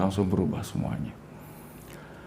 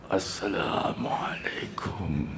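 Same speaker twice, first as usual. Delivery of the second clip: slow soft whispered salam; hushed slow salam; whispered greeting; 4 semitones lower, 11 dB quieter, much slower than usual. Retold or imitated. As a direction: breathe the greeting quietly. Greeting while entering, whispered.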